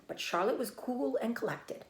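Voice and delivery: ordinary delivery, nothing marked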